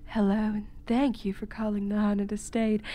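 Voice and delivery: breathy unvoiced greeting; seductive whisper. Shown as a demonstration. Host recites her phone greeting, seductive whisper. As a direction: purr the welcome softly. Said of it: "seductively saying".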